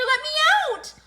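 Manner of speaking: high, loud, frantic shriek; panicked scream. A narrator's reading. Boy trapped in the collapsing tent, panicked scream. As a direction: scream to be freed. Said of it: "screamed Buster".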